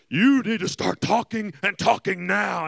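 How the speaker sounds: slow, very loud menacing mom; Mortal Kombat voice. Retold or imitated. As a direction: imitate a furious mother as game villain. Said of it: "straight up Mortal Kombat voice".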